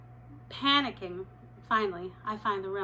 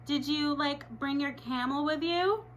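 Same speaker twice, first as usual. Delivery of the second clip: mocking kid yell; 6 semitones higher than usual. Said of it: "some kid yells".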